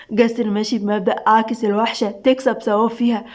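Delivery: ordinary delivery, nothing marked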